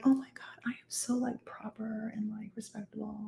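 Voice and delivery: whispered prim mockery; mock proper lady. Mocking her friend as a whispering proper lady. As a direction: breathe it out like a prissy snob. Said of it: "I'm pantomiming how you are".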